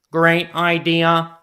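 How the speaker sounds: slow, even, loud robot; cheerful robot voice for a kids' story. Acted. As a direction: speak like a stiff toy robot. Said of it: "remember my robot voice".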